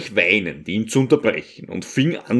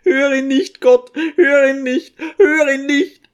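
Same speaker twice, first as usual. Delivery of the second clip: much higher, louder desperate pleading, building; frantic cry; 14 semitones higher, 4 dB louder than usual. A narrator's reading. Old mother crying to the icon, beside herself and loud. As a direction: beg God beside yourself to not hear him. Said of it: "rief außer sich".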